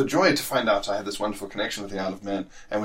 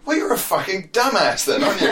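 much higher, louder mock-angry scolding, laughing; exasperated rant; 10 semitones higher, 4 dB louder than usual. To passport refusers, a laughing exasperated rant. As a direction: scold them like an idiot, half laughing. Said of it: "I look at it and I go".

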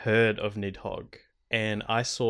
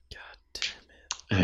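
slow whispered groan after a sigh; 3 semitones lower, 3 dB quieter, much slower than usual. Real, not acted. Commiserating with his co-host, slow sighing whisper. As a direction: let it out on a tired sigh. Listener sighs at sad story.

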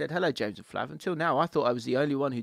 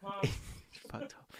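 breathy whispered disbelief; laughing whisper; 5 semitones higher, 12 dB quieter than usual. Real, not acted. Co-host's line suddenly drops.

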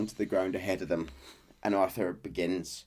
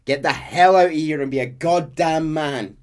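louder, higher, urgent push; forceful urging; 9 semitones higher, 10 dB louder than usual. Retold or imitated. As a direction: shove him away with words. Arthur urging John to leave.

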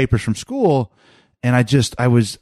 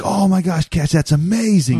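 excited praise; 7 semitones higher than usual. Retold or imitated. Dad praising son's perfect score.

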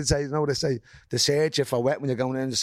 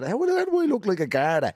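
higher-pitched, incredulous protest; indignant retort; 6 semitones higher than usual. Retold or imitated. Retelling his comeback to being called a guard, indignant. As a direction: demand an explanation, voice raised in pitch.

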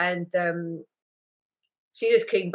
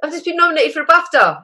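excited announcement; 11 semitones higher, 8 dB louder than usual. Retold or imitated. Retold telling postman her news.

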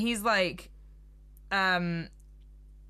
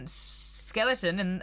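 ordinary talk, nothing marked

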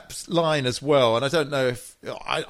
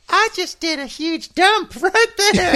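high, louder, slow kiddie voice breaking into a laugh; little kid voice; 6 dB louder than usual. Retold or imitated. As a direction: play a proud little boy, laughing through it. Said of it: "the kid turns around and goes".

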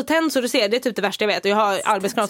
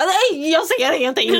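higher, slower, whiny helpless voice; mock helpless blind person; 6 semitones higher, 3 dB louder than usual. Mocking the carers who think she can't see, whiny. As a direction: play a hopeless person groping in the dark.

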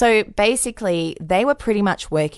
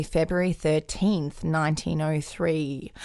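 normal talking voice

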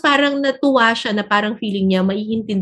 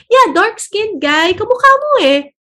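voicing the friend; 9 semitones higher, 3 dB louder, slower than usual. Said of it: "sabi niya, sabi niya".

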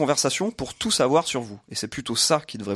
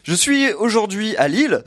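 ordinary talk, nothing marked

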